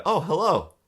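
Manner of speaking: high, loud startled greeting; the caller suddenly cheerful; surprised caller. Retold or imitated. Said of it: "And then he said".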